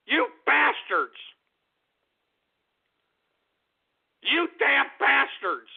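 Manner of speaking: hammed-up fury; slow angry yell. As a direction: spit each word. Ranting at prank callers.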